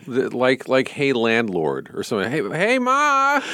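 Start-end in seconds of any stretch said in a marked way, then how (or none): 2.3-3.4 high drawn-out sitcom "Ma!"; sixties sitcom. Retold or imitated. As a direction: holler for Ma like a corny sitcom. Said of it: "sounded like a sixties sitcom".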